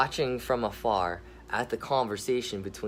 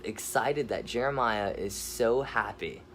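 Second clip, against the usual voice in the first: ordinary delivery, nothing marked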